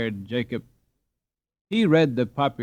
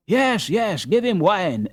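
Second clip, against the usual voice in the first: higher, eager chorus of agreement; the crowd eagerly echoes the call for wine; echoing crowd; 6 semitones higher, 4 dB louder, slower than usual. Acted. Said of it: "echoed everybody".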